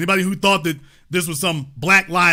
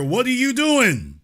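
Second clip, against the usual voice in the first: higher, slow, pressing demand; pointed question; 5 semitones higher than usual. Real, not acted. Host pressing listeners, higher and slow. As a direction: press them for an answer. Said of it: "I'm asking a question here".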